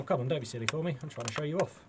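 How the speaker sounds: ordinary talk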